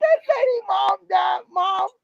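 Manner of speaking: put-on upset child telling her mom, high and crying. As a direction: blurt it out through tears like a little girl. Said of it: "I said".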